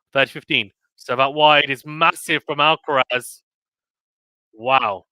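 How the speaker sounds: excited live sports call; play-by-play. Real, not acted. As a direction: call the rally as it happens.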